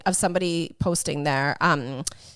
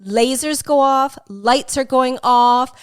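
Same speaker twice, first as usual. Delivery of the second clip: ordinary talk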